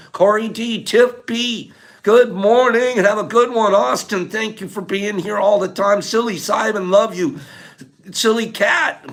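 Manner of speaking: high, loud affectionate shout-outs; calling out farewells. Real, not acted. Streamer signing off to viewers by name, calling out. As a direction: holler each name with love.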